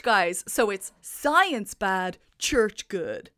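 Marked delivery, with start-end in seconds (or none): none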